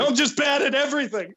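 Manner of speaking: high, loud tearful wail; hammed-up sobbing self-pity; comic breakdown. Real, not acted. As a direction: whine in mock despair, half laughing. Comic breakdown over botched intro.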